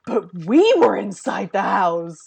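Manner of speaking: mock looping thought. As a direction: replay it like a stuck record. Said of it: "but it was".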